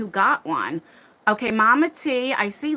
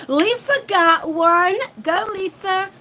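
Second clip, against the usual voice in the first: high gasping cheer, slowed on the name; excited cheering; 7 semitones higher than usual. Real, not acted. Streamer cheering as her friend Lisa wins a membership. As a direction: cheer your friend on with real delight. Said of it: "I get excited".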